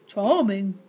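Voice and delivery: low, sarcastic blob voice; Slime exclaiming. Acted. Slime reacting to the insult, low and dry. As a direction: toss it off drily. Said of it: "exclaimed Slime".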